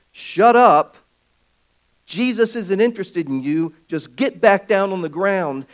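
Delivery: crowd telling him off. Retold or imitated. Crowd silencing the blind man.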